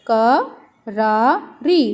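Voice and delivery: "करारी" stretched out slowly, each syllable held; slow drawn-out word. Shown as a demonstration. Teacher showing pupils the word, slowly drawing out "करारी". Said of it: "देखो".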